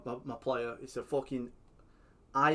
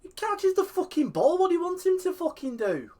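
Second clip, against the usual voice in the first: much higher, louder exasperated yelling; angry shout; 9 dB louder than usual. Real, not acted. Complaining about the keeper decision, shouting. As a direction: shout your disbelief. Ranting at referee.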